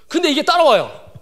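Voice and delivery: ordinary talk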